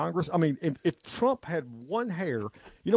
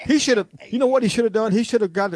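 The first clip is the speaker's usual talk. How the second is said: louder and higher, fast and heated; loud fired-up rant; 7 semitones higher, 6 dB louder than usual. Real, not acted. Host ranting on air about what Trump should do, loud heated burst. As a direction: blast it out, worked up and fast.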